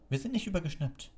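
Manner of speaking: high-pitched, indignant denial; thundering. A narrator's reading. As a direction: protest hotly, a mild thunder. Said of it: "donnerte Harry". (empört aufbrausend, hohe Stimme)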